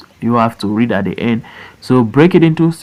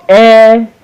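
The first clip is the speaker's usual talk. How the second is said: "elephant" chopped into three long beats; broken into syllables; 8 semitones higher, 5 dB louder than usual. Shown as a demonstration. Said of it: "Break it into syllable".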